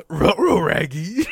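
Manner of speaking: high, loud cartoon dog; Ruh-roh Raggy as Scooby-Doo. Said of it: "in the Scooby-Doo voice".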